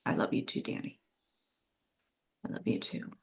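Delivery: breathless, barely voiced whisper on the verge of tears; tearful whisper. Real, not acted. To a dear friend, choked up, whispering her love back. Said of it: "Oh my God, I'm gonna cry".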